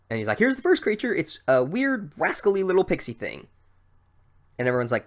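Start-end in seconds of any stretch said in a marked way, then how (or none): 0.4-3.5 high, theatrical showman announcement; showman teacher. Retold or imitated. Teacher unveiling a pixie to the class, hammy and high. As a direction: present the pixie like a ringmaster.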